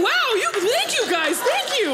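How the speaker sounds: high, loud, surprised thanks; thrilled by the crowd; delighted. Real, not acted. Comic thanking the cheering audience, delighted.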